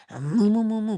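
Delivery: mooing in tune; singing cow moos. Retold or imitated. Imitating the cow in the ad, singing moos. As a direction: moo like a crooning cow. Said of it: "'cause it's the cow singing".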